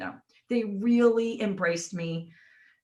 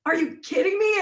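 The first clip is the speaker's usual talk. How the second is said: high incredulous question; high exasperated question; mock disbelief; 10 semitones higher, 4 dB louder than usual. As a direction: ask the friend if he's serious. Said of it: "I'm like".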